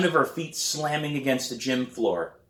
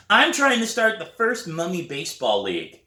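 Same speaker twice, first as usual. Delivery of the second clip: put-on high, eager kid voice; little brother voice; 9 semitones higher, 4 dB louder than usual. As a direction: pitch it up like an excited little boy. Little brother Brian speaks.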